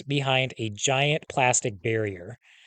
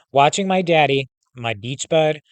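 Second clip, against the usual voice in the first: ordinary delivery, nothing marked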